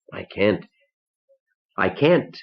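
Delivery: words catching, rising in distress; choked up, stuck. Acted. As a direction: unable to get it out. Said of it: "and I stuck".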